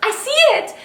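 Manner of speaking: high, surprised I see it; surprised friend. Retold or imitated. Friend spotting the baby coming, surprised. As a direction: blurt it out in shock. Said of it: "she goes".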